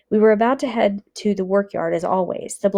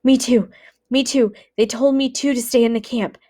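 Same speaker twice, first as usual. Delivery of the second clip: out of breath; 5 semitones higher, 4 dB louder than usual. Acted. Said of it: "He was out of breath".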